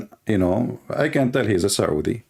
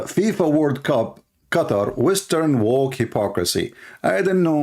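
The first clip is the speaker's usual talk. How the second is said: ordinary delivery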